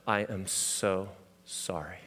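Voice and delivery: soft, slow, quiet condolence; a soft, slow heartfelt apology; tender hushed sorry. Retold or imitated. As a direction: say it gently, eyes locked on him. Pastor consoling grieving friend.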